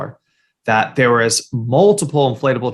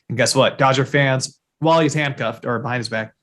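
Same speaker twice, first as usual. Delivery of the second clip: usual speaking voice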